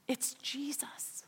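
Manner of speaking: slow, breathy whisper of the name; hushed reveal. Acted. Preacher revealing Jesus to the congregation, whispered.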